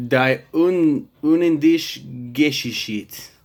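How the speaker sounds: put-on German title in a slow, heavy Russian accent. Explaining the film's German title, in a Russian accent. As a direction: drag the German words out like a Russian would. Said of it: "I said that with a Russian accent".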